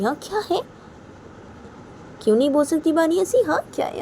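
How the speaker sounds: high, needling questions; acted challenge. Chacha taking on Raima's abbu, high acted challenge.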